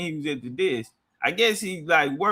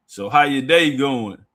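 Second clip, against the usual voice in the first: lower, louder masseur's sing-song greeting; masseuse voice; 6 semitones lower, 4 dB louder than usual. Retold or imitated. Massage worker greeting a startled customer, lower and louder. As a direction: greet him like the massage lady.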